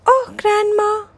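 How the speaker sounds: put-on little girl voice. A narrator's reading. Child Biff speaking.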